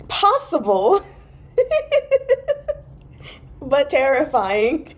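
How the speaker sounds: high, laughing and slow; giggly disbelief, drawn out. Real, not acted. Laughing reaction.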